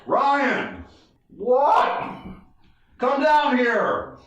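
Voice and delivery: high, drawn-out shouted summons; calling up high. Retold or imitated. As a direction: imitate hollering between street and rooftop. Cop calls up to rooftop.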